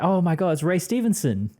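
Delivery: high, loud sudden recognition; excited recognition. Retold or imitated. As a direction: blurt it out, thrilled. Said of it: "my actor recognition was like".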